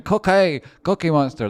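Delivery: loud high Cookie Monster call; Cookie Monster shout. Retold or imitated. Streamer echoes a donor's Cookie Monster shout, loud and high. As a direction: yell the name like the donor said it. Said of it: "That's how he said it as well".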